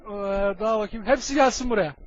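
ordinary talk

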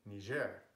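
Niger said as nee-ZHAIR; French pronunciation. Shown as a demonstration. Natives keeping their pronunciation, French pronunciation. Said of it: "keep their pronunciation of".

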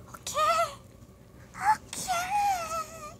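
very high tiny squeal; silly squeak. Pretend. Vlogger playing around after battery check, silly squeak.

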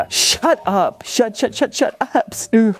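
tearful, stuttering high protest; crying. Acted. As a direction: stammer it out through tears, pitched high. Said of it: "Oh, are you crying now?".

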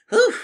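loud exhausted puff of relief; gasped whew. A narrator's reading. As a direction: let out a big gasp. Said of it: "she gasped".

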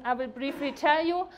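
ordinary delivery

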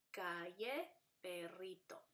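"calle" as ca, lle, then "perrito" as pe, rri, to; split into syllables. Shown as a demonstration. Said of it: "se vería como este".

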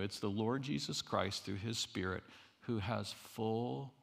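ordinary talk, nothing marked

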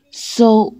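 ordinary talk, nothing marked

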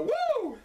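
high, shrieking wrestler's woo; a strutting champion's woo; Ric Flair woo. Acted. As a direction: let out the signature Flair woo. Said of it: "Ric Flair would break into my house".

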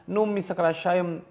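normal talking voice

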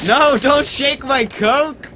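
high squeaky character voice, slow; high whiny voice. Pretend. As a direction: whine it like a cartoon kid. Character voice line.

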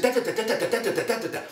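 fast, high, rattling imitation; machine-gun speech. Imitating the idol's torrent of words, machine-gun patter. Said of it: "He spoke in machine gun".

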